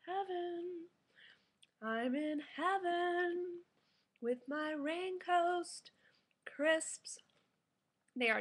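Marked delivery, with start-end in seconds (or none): 0.0-7.2 slow, high, dreamy savoring; blissful. Real, not acted. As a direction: melt into the taste, slowly. Tasting bite, blissed out.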